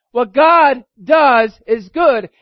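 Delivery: usual speaking voice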